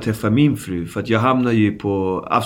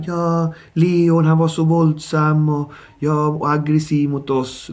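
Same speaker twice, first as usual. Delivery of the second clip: mocking whiny police; 5 semitones higher, slower than usual.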